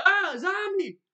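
high, startled yelp; hammed-up shriek of surprise; mock startled cry. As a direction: jump back in mock fright. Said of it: "he said".

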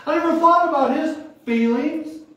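preacher driving home his regret; rising emphasis. Acted. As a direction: push it up with conviction. Repeated line, building to force.

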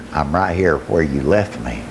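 low, calm answer; voice of God. Retold or imitated. God answering the desperate father, voice of God. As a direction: drop low and answer simply. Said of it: "I heard a voice that says".

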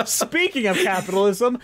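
laughing through the words. Real, not acted. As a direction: still giggling as you talk.

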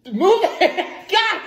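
high, loud, laughing outburst; exasperated. Real, not acted. Coach urging partner through a handshake, laughing exasperation. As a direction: burst out in amused frustration.

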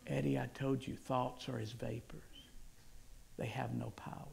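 quiet, low-pitched, deliberate words; Jesus reassuring. Retold or imitated. Jesus repeating his assurance to Eddie, low and calm. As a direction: speak low and soft, settling to a hush. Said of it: "And the Lord Jesus said".

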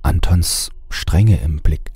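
ordinary talk, nothing marked

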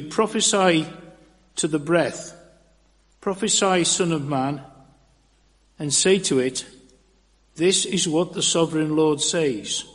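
normal talking voice

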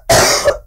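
coughing fit; a dry cough. Real, not acted. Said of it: "my voice is getting really bad".

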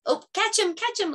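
high, eager call to catch the bug; excited catch call. Pretend. Teacher playing a bug-catching game, excitedly calling. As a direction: urge the kids on, playfully excited.

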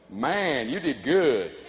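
low, measured compliment to a coworker; drawn-out praise. Shown as a demonstration. Speaker models praise for the audience to copy, low admiring. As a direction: give praise low and unhurried.

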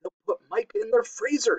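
high, tense chipmunk hiss; small, high, urgent hiss. A narrator's reading. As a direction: hiss it in alarm. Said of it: "hissed Huggledug".

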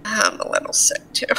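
near-whispered admission; weak admission. Acted. Gil telling his friend he's sick, weak whisper. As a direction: murmur it softly, almost voiceless. Gil answers apology weakly.

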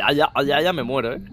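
low, mock dying groans; dying moan. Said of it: "como hago otra vez el ay".

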